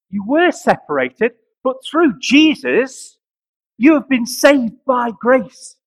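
loud, slow, high preaching with long pauses; full-force preaching. Acted. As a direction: proclaim it to the congregation.

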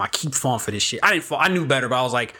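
normal talking voice

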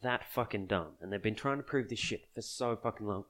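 normal talking voice

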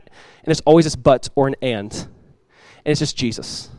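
ordinary talk, nothing marked

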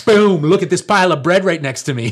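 mock Jesus unveiling bread pile; showman reveal. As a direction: announce the bread like a magic trick.

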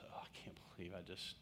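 hushed whisper of dismay; whispered regret. Retold or imitated. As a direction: whisper as if caught in a blunder. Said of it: "my first thought was".

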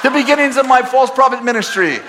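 high, loud laughing punchline; preacher roasting himself, laughing; laughing self-mockery. Real, not acted. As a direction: shout it out while laughing at yourself.

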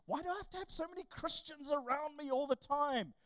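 high complaining schoolkid voice; whiny student. Retold or imitated. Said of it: "you're at school and it's like".

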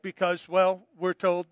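ordinary talk

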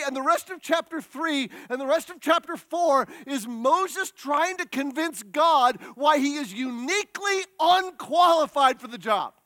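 ordinary talk

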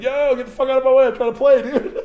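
loud, high yell at the cops, laughing; mock yell. Retelling how he yelled at the cops to let him play, mock shout. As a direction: bark it like he won't stop gaming.

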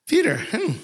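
high teasing hum; lightly voiced amused Jesus; playful Jesus. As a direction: tease him like a friend.

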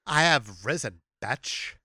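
low, hushed mock-grand diva; diva queen. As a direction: announce your return low and soft, savoring it. Aragorn as diva, mock-grand return.

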